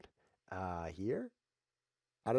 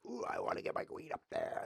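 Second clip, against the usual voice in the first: eager whisper; 13 semitones higher, 5 dB quieter than usual. Retold or imitated. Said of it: "and you're like".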